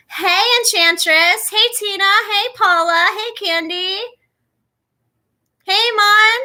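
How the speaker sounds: high, loud, drawn-out hellos; bright greetings. Real, not acted. Greeting each viewer joining her live stream, high and loud. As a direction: welcome each name warmly and slowly.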